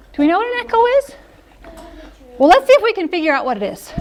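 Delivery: put-on high, fast teacher voice for kids; bright questions to draw preschoolers in; teacher coaxing the class; storytime voice.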